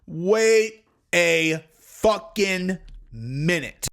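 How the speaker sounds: dawning suspicion, dragged out; slow drawn-out realization. Retold or imitated. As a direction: stretch each word out, very slow. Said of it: "I started going".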